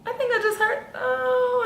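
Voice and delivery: high pained little oh; imitating the tech. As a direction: squeak it like you pressed too hard, pitched high. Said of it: "She was like".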